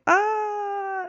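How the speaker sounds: high-pitched held "aah"; hammed-up high yelp of alarm; mock panicked cry. Teacher re-enacting losing his ring, mock high scream.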